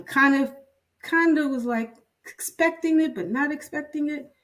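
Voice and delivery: fighting back tears, voice cracking upward; voice breaking up. Real, not acted. Patient hearing bad news, voice breaking with emotion. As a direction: pitch jumps high as emotion breaks through.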